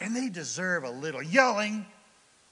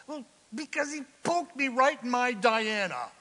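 high-pitched offended complaint; hammed-up, high-voiced grievance; mock indignation; 7 semitones higher, 3 dB louder than usual. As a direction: act out a wounded protest.

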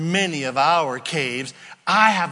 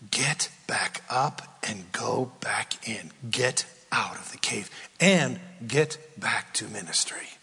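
lightly voiced God; 5 semitones lower, 6 dB quieter than usual. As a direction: calmly send him back. Voices God commanding Elijah.

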